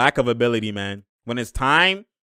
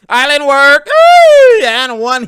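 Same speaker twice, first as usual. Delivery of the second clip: loud, high-pitched whoop at a big basket; excited hype shout; 11 semitones higher, 13 dB louder, much slower than usual. Real, not acted. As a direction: burst into high, loud celebration.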